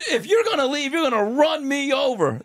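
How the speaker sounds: high, strained plea; desperate threat. Retold or imitated. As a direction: dare them to run you over. Bill threatening to lie down.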